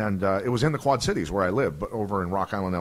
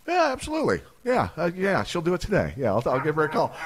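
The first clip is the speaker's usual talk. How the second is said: mock eager agreement; 7 semitones higher than usual. Pretending to agree to wire money.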